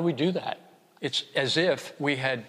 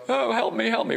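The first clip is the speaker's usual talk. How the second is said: mock desperate plea; 11 semitones higher, 4 dB louder than usual. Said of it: "First we go".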